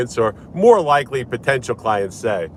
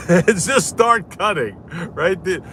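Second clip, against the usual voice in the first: normal talking voice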